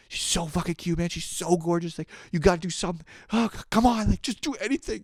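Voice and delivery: high, fast excited inner voice; mock frantic inner cheerleader. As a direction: egg yourself on, squeaky and urgent. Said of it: "another dialogue in my head".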